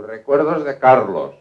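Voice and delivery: acted booming hello; hearty greeting. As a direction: deliver the regards in a big, drawn-out voice. Same greeting repeated insistently.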